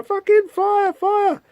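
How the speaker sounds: high, frantic yelp at the jammed gun; panicked kid. Acted. Terrified Liverpool kid whose gun won't fire, high panicked yelp. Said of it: "he's going".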